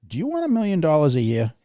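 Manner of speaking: hammed-up dream offer at the door; imagined visitor's offer.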